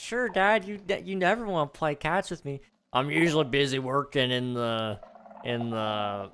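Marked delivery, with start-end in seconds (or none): 0.0-2.6 put-on high, eager little boy voice; squeaky kid complaining to dad. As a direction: play the whiny little son. Kid voice in skit.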